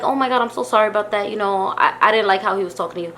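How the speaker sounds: retold gentle, hushed sympathy; sweet apology. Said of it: "like, so sweet".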